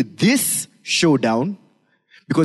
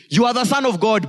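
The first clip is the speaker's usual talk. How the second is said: mock demon yell; 7 semitones higher than usual. Preacher quoting demons who are not worshiping God, mock yell. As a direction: call it out high, half yelling. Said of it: "They are yelling".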